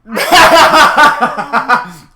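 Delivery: loud burst of laughter; big laugh. Real, not acted. Player cracking up at a crude in-game line, loud burst of laughter. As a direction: explode with laughter.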